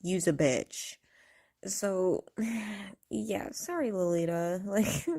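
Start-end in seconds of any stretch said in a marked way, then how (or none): 0.0-1.0 "Use a bitch" dropped lower, cold and final; mean every word of "Use a bitch"; truly meaning it. Shown as a demonstration. Showing when she really means it, a cold, lower "Use a bitch". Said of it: "that's when I'm truly, like".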